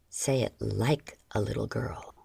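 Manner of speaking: low breathy male voice; low breathed command. A narrator's reading. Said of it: "he breathed".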